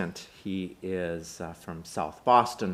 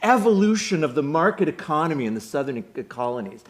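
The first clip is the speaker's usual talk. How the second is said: high, loud, showy academic lecture; Clark; 9 semitones higher, 6 dB louder than usual. Acted. Grad student challenges Chucky on market economy, high-pitched know-it-all performance. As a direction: rattle off the theory to show someone up. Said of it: "Clark, the name of the character, says".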